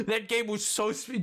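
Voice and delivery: usual speaking voice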